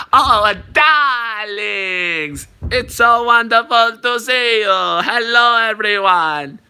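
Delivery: high, drawn-out grand greeting; hammed-up diva designer voice; Edna Mode. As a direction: gush theatrically to your darlings. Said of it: "Edna Mode".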